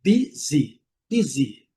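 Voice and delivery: "Busy" with a well-sounded final "ee"; clear i sound. Shown as a demonstration. Teacher stressing final Y, clearly pronounced "Busy". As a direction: sound both i vowels in "Busy" fully. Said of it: "muito bem pronunciada com som de I".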